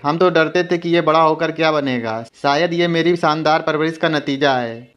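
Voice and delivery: ordinary delivery, nothing marked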